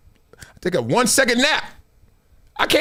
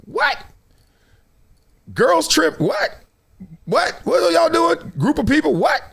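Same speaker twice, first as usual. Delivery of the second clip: rising, disbelieving whats, much higher; incredulous boss; 6 semitones higher than usual. Retold or imitated. Boss reacting to her girls trip story, mock disbelief. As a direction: play the boss who can't believe the excuse. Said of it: "your boss probably took a little walk and said".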